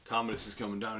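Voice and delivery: ordinary talk, nothing marked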